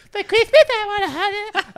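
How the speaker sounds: put-on high squeaky old lady voice; mock old lady. Mocking the old lady next to him, high squeaky voice. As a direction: pitch it way up like a shocked granny.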